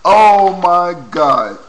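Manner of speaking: loud, slow, amazed oh my God; drawn out in real excitement; excited outburst. Real, not acted. Excited reaction to video.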